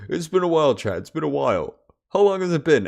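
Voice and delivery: high, loud self-imitation; mock stream opener. Streamer mimicking their own habitual greeting to chat, mock-excited. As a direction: exclaim it like a hyped-up streamer. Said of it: "I just go".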